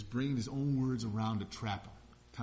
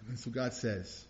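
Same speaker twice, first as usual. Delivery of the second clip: ordinary delivery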